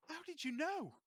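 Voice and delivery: very high put-on character voice; comic high-pitched amazement; delighted Les. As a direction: squeal it like a surprised gift recipient. GM acts Les given a gift.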